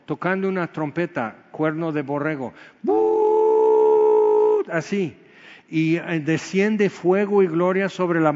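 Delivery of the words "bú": long high horn blast, loud; ram's horn blast. Retold or imitated. Preacher imitating the ram's horn trumpet on Sinai, loud high blast. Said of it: "con un son de trompeta largo".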